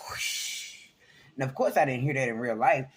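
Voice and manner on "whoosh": soft breathy whoosh; whoosh sound. Shown as a demonstration. Imitating the orb flying past her ear. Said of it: "it goes".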